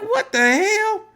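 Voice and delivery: high, slow startled exclaim; father stunned at the window, slow and high. Retold or imitated. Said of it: "I was like".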